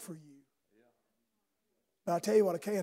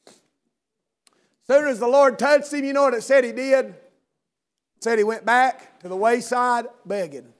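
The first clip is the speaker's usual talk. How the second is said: loud, high, fervent sermon delivery; preaching at full force; 5 semitones higher, 10 dB louder, slower than usual. Acted. Preacher exhorting his congregation, shouting fervently. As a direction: press the point hard on the congregation.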